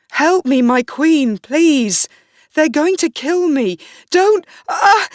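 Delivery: loud, high, rising to a final shriek; terrified screaming. Acted. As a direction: beg the queen as they drag you off. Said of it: "he screamed".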